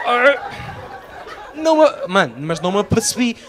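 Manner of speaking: ordinary delivery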